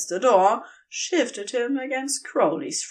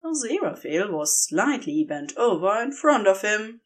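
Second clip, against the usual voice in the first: ordinary delivery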